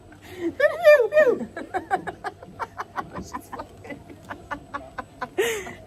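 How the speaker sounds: long high fit of laughter; long laughing fit. Real, not acted. Stuck on Buzz Lightyear with friends, laughing helplessly. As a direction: crack up helplessly.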